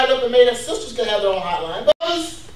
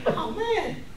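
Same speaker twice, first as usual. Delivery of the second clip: soft, higher mock sigh of words; mock wistful reaction; 4 semitones higher, 9 dB quieter than usual. Stressed churchgoer seeing a smiling friend, softly wistful. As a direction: quietly act out being won over.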